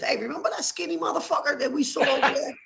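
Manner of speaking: high-pitched, eager question; excited recounting. Retold or imitated. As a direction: pitch it way up, telling the kids. Acts out telling his kids.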